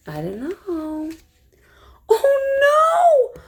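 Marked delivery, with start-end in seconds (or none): 2.1-3.4 high, loud cry of alarm; a sudden frightened yell, much higher; panicked cry. A narrator's reading. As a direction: shriek it out in panic. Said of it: "I cried out".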